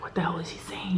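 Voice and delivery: hushed, breathless what the hell; spooked, under the breath; scared whisper. Retold or imitated. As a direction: whisper it so he can't hear. Scared, muttering to herself.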